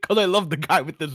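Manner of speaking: loud, high and thrilled; gushing with excitement; excited. Real, not acted. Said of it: "I'm so excited".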